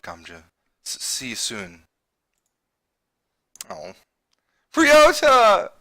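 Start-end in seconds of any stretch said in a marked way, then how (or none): 4.7-5.7 laughing call. Real, not acted. Calls after fleeing Ryota.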